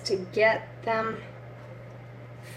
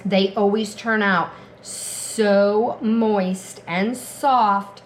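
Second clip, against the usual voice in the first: normal talking voice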